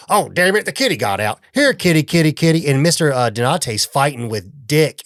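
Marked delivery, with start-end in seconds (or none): 0.1-2.7 high, loud mock call of here kitty; hammed-up Junk calling for the lost cat. Junk calling the runaway cat, high and loud. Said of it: "Junk's like".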